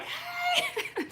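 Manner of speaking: very high laughing squeal; giddy high hey through laughter; squealed greeting. Retold or imitated. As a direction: greet your boy at pickup with a squeal. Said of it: "I be like".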